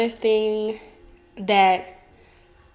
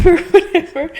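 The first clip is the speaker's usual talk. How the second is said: high, loud laugh tossed off with a shrug; 9 semitones higher, 9 dB louder than usual. Real, not acted. Vlogger laughing at the imagined daughter's proud announcement. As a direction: laugh at your own silly example.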